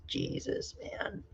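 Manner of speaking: slow hushed disbelief; stunned whisper. Real, not acted. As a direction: breathe it out in shock. Shocked reaction to price.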